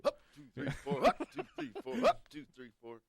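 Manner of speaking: high sing-song count, punching two; mock sing-song count. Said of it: "every time you say two".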